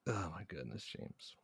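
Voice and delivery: whispered, fading despair; breathy whisper. Real, not acted. Cohost despairing to James about the losing streak, whispered. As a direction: whisper it in defeat.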